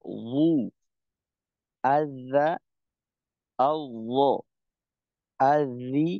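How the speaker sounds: "রা", "ল", "জু", "আজা" said alternately, each kept distinct; contrast drill. Shown as a demonstration. Teacher contrasting two letters for students, clear syllables. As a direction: set "আল ল" against "আজা", one clear syllable at a time. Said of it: "পার্থক্যটা হয় এই ধরনের".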